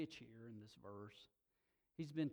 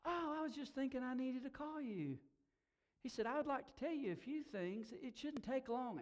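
higher-pitched, chatty eagerness; eager friend on phone; 10 semitones higher than usual. Retold or imitated. Friend greeting the preacher on the phone, high and eager. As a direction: voice the friend lighting up on the call.

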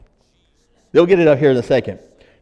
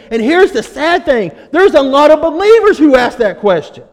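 loud, higher, building sermon voice; preaching at full force; 5 semitones higher, 5 dB louder than usual. Acted. Pastor preaching hard to the church, loud and rising. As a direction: drive it home to the congregation.

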